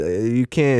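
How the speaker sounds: normal talking voice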